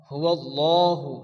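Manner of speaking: হুয়াল্লাহু slowly, with a dark heavy l; full heavy lam. Shown as a demonstration. Teacher introducing the next word, slow heavy lam in হুয়াল্লাহু. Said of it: "পুর অর্থাৎ ল পড়তে হয়".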